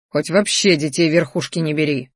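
ordinary delivery, nothing marked

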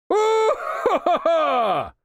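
very high, slow shouted laughter; triumphant cackle. Acted. King exults at the third miss, shouting laughter. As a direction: crow with triumph. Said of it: "ликовал король".

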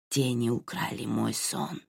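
quiet hoarse wheeze; hoarse whistling rasp. Acted. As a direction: breathe the words out raspy and whistling. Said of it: "раздался хриплый, с присвистом".

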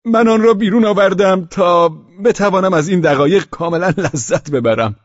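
put-on high, loud drunken man's voice; tipsy Pavel. A narrator's reading. Drunk host explains his missing coat, giddy and high. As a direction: play the giddy drunk widower.

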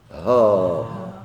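"ها" as one sounded syllable with long aa; letters read as a word. Shown as a demonstration. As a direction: sound out "ها", not the letter names. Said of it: "ما تقولش الهاء والألف". (نطق «ها» بمدّ الألف)

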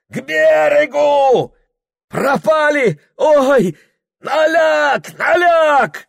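high, loud panicked yelling; desperate shout. Acted. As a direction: scream over the roaring water. Boat hits the rapids. (отчаянный крик)

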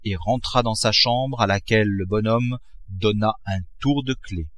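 usual speaking voice

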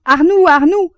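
loud, high howl of a feigned nightmare. A narrator's reading. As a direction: howl the name to wake someone up. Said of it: "il réveillait son compagnon en hurlant". (hurlement de faux cauchemar)

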